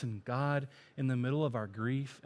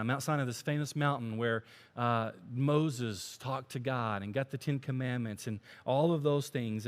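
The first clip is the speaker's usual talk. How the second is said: ordinary talk, nothing marked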